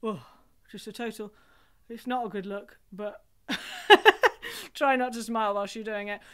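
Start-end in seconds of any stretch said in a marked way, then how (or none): 3.5-4.7 loud laugh. Real, not acted. Laughs at monkey face.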